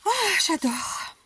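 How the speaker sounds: « oh » aigu et étiré, puis descente; s'extasier lentement, voix haut perchée; ravissement. Real, not acted. S'extasie devant la carte. (admiration fondante, vraiment ravie)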